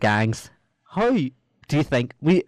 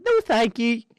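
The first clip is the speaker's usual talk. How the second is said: mock polite refusal; 10 semitones higher, slower than usual. Refusing drugs from Bean.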